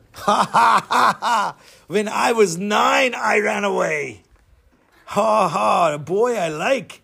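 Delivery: put-on slow, boisterous accented giant; hammed-up villain laughter and glee; huge man's booming laugh. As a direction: roar with delight, then boast slowly. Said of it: "three hundred pound, seven-foot man".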